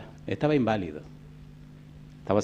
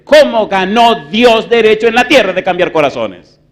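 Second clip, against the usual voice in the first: loud, high-pitched, driving sermon delivery; full-force preaching; 9 semitones higher, 11 dB louder than usual. Real, not acted. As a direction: thunder the question at the congregation, building higher. Preaches a rising question. (predicación a toda voz)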